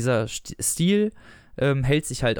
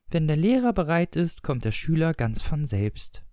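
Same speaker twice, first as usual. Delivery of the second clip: ordinary delivery, nothing marked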